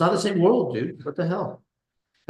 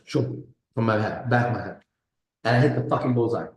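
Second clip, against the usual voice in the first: ordinary talk, nothing marked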